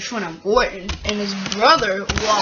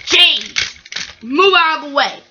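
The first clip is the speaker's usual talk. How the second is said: raised, louder warning call; 4 semitones higher, 4 dB louder than usual. Acted. Telling James to clear the way, calling out.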